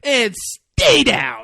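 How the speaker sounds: big, slow, high shout; triumphant mock shout. Man kicks the gun from Hugo, triumphant mock shout. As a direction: bellow it slowly, hamming up the moment. Repeats the man's shouted line.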